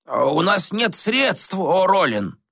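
a lightly voiced reproachful sage; old ascetic. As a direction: scold the prince like a worried elder. Gomon voice.

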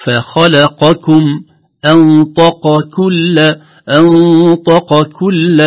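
"أنطق كل" slow, heavy q then light k; qaf then kaf. Shown as a demonstration. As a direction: keep "أنطق كل" q and k distinct. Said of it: "ننتقل مباشرة بعد القاف". ("أنطق كل" بقاف مفخمة وكاف مرققة)

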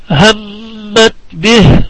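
very slow, humming long on the doubled م of همت; slow recitation with a drawn-out nasal م in همت; tajweed ghunnah recitation. Shown as a demonstration. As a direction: hold the مّ of همت nasally for two counts. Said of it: "الميم المشددة من كلمة همت".

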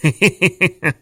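teacher's loud scornful chuckle; hearty mocking laughter. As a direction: laugh at the kids' silly ideas. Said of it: "опять засмеялся".

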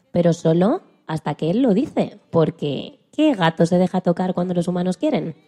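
ordinary delivery

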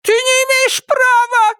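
high, shrill yell; shouting. Acted. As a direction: scream it in outrage. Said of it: "закричала она".

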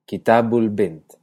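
kitabul bint, despacio y marcado; árabe kitabul bint. Shown as a demonstration. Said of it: "uno dice libro la niña". (kitabul bint, lento y claro)

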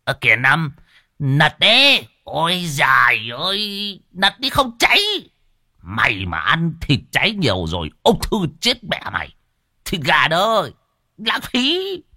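put-on high, squealing tipsy old man; a drunk nagging over burnt meat; shrieking drunk. A narrator's reading. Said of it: "Lâu lâu lão lại ré lên". (ông lão say ré lên the thé)

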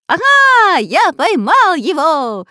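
very high, loud, wavering crow; triumphant shout. A narrator's reading. Robber clutching the villain, shouting in triumph. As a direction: crow it loudly, stretching every word. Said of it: "кричал разбойник".

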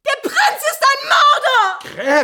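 manic shout. Acted. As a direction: scream the accusation with wild laughter. Countess shouts the accusation.

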